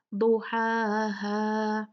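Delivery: very slow "दुहाहा" with a thick opening d; drawn-out "दुहाहा"; heavy daad. Shown as a demonstration. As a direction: recite "दुहाहा" with dark "दु" and long vowels. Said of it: "ये heavy है".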